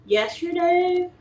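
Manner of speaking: surprised. Real, not acted. Echoing question surprised.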